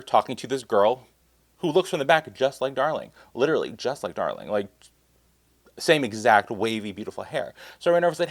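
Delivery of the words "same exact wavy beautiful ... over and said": usual speaking voice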